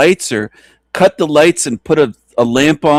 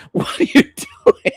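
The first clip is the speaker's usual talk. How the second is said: laughing; 11 semitones higher, 3 dB quieter, faster than usual. Real, not acted. Laughing at clip.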